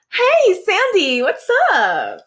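high, loud mock mom greeting; imitating mom. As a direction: ham up mom's chirpy hello. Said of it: "I'll do it like you".

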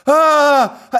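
high, loud mock scream; imitated distant yell. Host imitating the neighbour still yelling downstairs, high and loud. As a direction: echo the old man's cry from downstairs. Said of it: "all I can hear is like".

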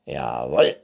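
low, loud unicorn voice; Neinhorn. A narrator's reading. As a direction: confirm it firmly. Unicorn figure confirming. (tiefes, lautes Einhorn)

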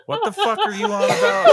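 high, loud, laughing disbelief; laughing shout. Real, not acted. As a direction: yell it mid-laugh.

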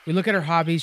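normal talking voice

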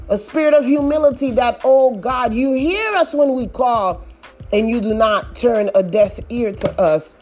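ordinary talk, nothing marked

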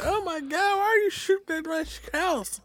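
very high-pitched mock woman's voice; shocked chick impression. Chick reacting to her house being shot at, in a high mock-shocked voice. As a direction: squeal it like a horrified girlfriend. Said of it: "She was like".

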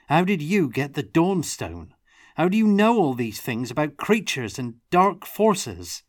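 high, loud demanding questions; insistent. A narrator's reading. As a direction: press him hard for answers. Tomas pressing Brody for answers.

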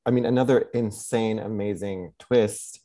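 normal talking voice

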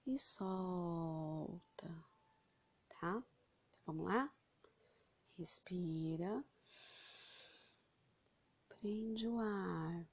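slow, soft meditation voice; slow breath counting. Acted. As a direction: guide each breath in and out, slowly. Guides breathing slowly.

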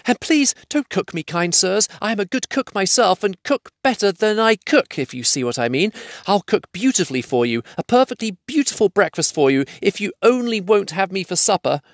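high, frightened hobbit pleading; anxious, wheedling little hobbit; gasping plea. A narrator's reading. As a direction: beg for your life in a squeaky voice.

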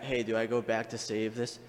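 ordinary delivery

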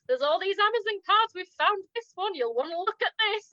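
high-pitched, eager mock urgency; excited discovery. Detectives finding the Amazon cards, excited and urgent.

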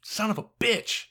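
high-pitched, mock-exasperated curse; retold exasperation. Podcaster voices reaction to awful characters, exasperated.

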